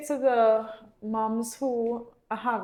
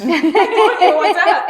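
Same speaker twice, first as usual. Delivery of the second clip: laughing mock child; 7 semitones higher, 12 dB louder than usual. Mom voicing defiant child, laughing.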